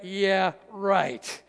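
skeptical dismissive yeah right; sarcastic scoff. Retold or imitated. Listener doubting the verse, skeptical scoff. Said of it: "You're like".

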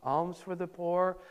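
a lightly voiced weary beggar chanting slowly; mild, slow, passionless beggar's chant; monotone begging call. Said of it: "doing it in a monotone, kind of impassionate way".

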